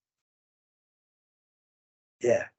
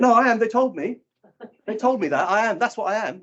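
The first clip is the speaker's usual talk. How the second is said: put-on insistent teenage boy; 8 semitones higher than usual. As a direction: insist you are, like a defensive kid. Boy insists on his labels.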